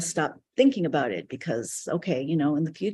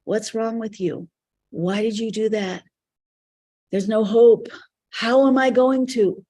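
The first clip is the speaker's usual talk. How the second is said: raised, higher self-critical voice; berating inner voice; 5 semitones higher, 6 dB louder than usual. Retold or imitated. Inner voice putting you down, berating and increasingly loud. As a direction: act out a nagging inner critic, pitched up and building louder. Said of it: "voice that is constantly putting you down".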